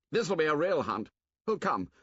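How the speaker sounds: put-on high, keen boy's voice; rally them to the hunt; eager hunter boy. Jack calls the boys to a hunt, eager.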